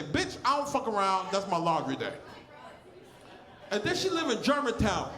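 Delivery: ordinary talk, nothing marked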